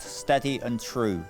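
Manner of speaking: usual speaking voice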